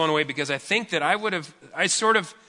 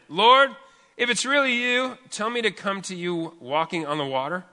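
Peter calls out across the water, raised; calling out; 5 semitones higher than usual. Acted.

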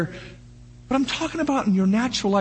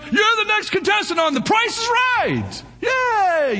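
game show announcer; 12 semitones higher, slower than usual. Retold or imitated. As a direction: hype the contestant like a TV host. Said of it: "They'd say".

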